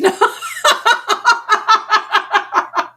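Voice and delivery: high, loud burst of cackling laughter; loud cackle. Real, not acted. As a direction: cackle long and high at your own joke. Jokes men are not safe.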